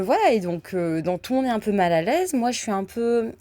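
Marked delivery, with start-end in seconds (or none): none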